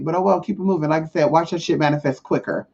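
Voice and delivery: ordinary talk, nothing marked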